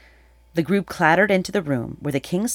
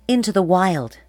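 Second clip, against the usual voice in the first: louder, higher reply cutting into the confession; pointed interjection; 5 semitones higher, 5 dB louder than usual. A narrator's reading. Atalanta completes the king's account of the abandoned baby, pointed interjection. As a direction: finish the thought firmly.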